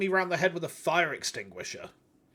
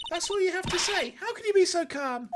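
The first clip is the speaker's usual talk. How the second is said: high, incredulous sidekick voice; much higher, exasperated character voice; indignant assistant; 10 semitones higher than usual. Acted. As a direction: squeak it in disbelief, pitched far up. Assistant scolds Nick for being calm.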